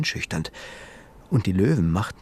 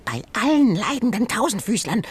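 high, squeaky creature gasping in horror; a frightened little animal exclaiming; high terrified critter voice; trembling horror; 11 semitones higher than usual. Acted. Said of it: "bebte vor Entsetzen".

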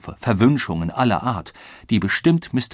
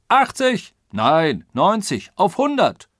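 loud, high, mounting calls; excited outbidding; 9 semitones higher, 5 dB louder, slower than usual. A narrator's reading. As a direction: outbid each other like an auction. Passengers outbid each other excitedly. (aufgeregt überbietend, laut und hoch)

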